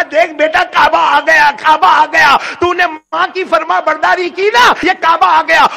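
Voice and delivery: high and loud with joy; a mother hugging her son, shouting that the Kaaba has come; joyful mother. Retold or imitated. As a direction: cry it out in wonder. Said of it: "ماں نے گلے لگا کر کہا".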